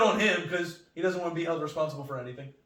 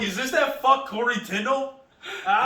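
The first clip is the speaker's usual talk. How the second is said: loud, raised, accusing question; mock confrontational; 7 semitones higher, 7 dB louder than usual. Paramedics turning up to a podcast critic, mock aggressive. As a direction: challenge him with exaggerated resentment. Acting resentful paramedics finding him.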